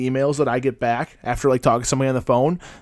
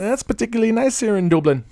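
put-on high, lilting Dublin accent; mock Irish; 9 semitones higher than usual. Host mimicking how co-host sounds, teasing Irish. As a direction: exaggerate an Irish accent, pitched up. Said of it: "That's what you sound like".

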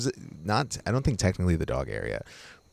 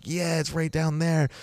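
eager reply; 10 semitones higher, slower than usual. Retold or imitated. Telling the hostess about the Jeep.

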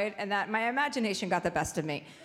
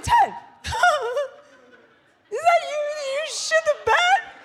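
mock shock; 6 dB louder than usual. Wife teasing her husband about the bed, feigned shock. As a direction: act stunned, much higher and louder. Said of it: "And I was like".

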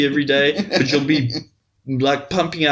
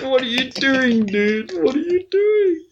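mock startled protest; 9 semitones higher than usual. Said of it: "I'm like".

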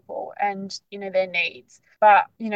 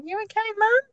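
put-on high little-kid voice, lifting at the end; imitated child; 13 semitones higher than usual. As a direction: check on mum like a small child. Imitating children checking on mum.

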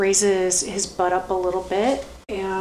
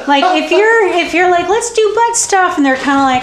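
laughing; 8 semitones higher, 5 dB louder than usual. Real, not acted. Laughing while explaining.

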